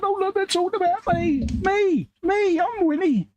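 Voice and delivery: very high, put-on voice of a self-important aunt; mock Auntie Winnie. Mocking the aunt's self-importance in a very high voice. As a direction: squeak it out like a vain old auntie. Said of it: "She'd be sat there at home going".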